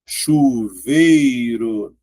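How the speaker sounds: "Chuveiro" very slow, each syllable stretched; drawn-out classroom breakdown of "Chuveiro". Shown as a demonstration. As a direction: sound out "Chuveiro", holding "vei" as one glide. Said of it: "Aí aqui".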